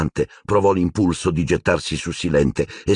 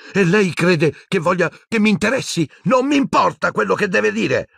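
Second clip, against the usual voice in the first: much higher, exploding retort; blurted outburst; 14 semitones higher than usual. A narrator's reading. Boy snapping at headmaster, much higher. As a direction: burst out in contempt. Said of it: "sbottò Harry".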